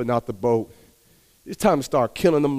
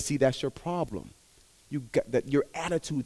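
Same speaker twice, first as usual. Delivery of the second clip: quiet rebuke; 9 dB quieter than usual. Acted. God rebukes him.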